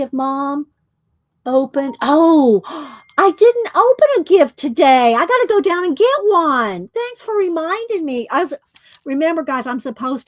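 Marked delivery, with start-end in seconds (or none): 2.0-8.3 sudden realization. Real, not acted. Suddenly remembers she forgot gift.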